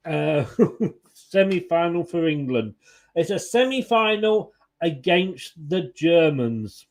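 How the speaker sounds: ordinary talk, nothing marked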